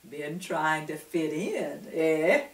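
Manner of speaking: knowing gentle. A narrator's reading. Eli speaks kindly.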